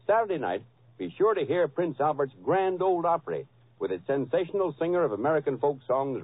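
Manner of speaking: ordinary talk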